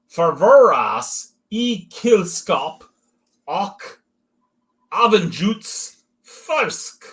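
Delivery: clumsy slow put-on German accent; mock German accent. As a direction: sound out the label in a bad German accent. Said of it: "you're making it sound German".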